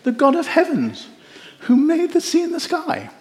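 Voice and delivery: proud prophet announcing his God; pious voice. Acted. Jonah answering the sailors, pious and high. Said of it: "Don't know why I do that voice".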